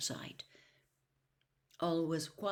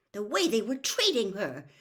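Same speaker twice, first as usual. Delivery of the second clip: louder indignant start; furious; 5 semitones higher, 5 dB louder than usual. A narrator's reading. Hermione outraged at the elf's treatment, furious. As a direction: spit it out furious. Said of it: "said Hermione furiously".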